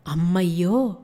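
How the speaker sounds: a short startled gasp of fear; frightened cry. Acted. The girl gasps in fear at the parrot's news. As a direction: cry out, suddenly scared. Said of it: "Leelasundari కేకేసింది".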